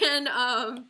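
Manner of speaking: loud laugh breaking into a cry; laugh into sob. Real, not acted. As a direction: laugh that tips into crying. Laugh turning to tears.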